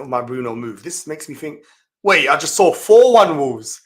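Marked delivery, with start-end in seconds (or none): 2.0-3.8 much louder, startled exclamation; shocked outburst. Real, not acted. Streamer spotting a shocking scoreline, loud startled outburst. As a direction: blurt it out in disbelief.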